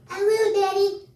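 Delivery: put-on high, slow small-child voice; little boy voice. Little son answering his dad, high child voice. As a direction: squeak it like a kindergartner.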